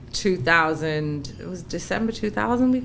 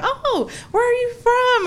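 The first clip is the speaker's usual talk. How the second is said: very high, gushing interest; delighted curiosity; 3 dB louder than usual. Retold or imitated. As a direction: imitate a charmed stranger, pitched way up. Acts a delighted stranger.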